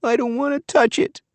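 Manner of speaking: put-on high, small child's voice, a touch scared; frightened little girl. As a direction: play the reluctant little girl, pitched high. Frightened child refuses to touch chicken.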